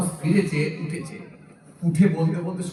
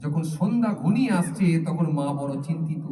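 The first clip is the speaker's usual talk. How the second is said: ordinary delivery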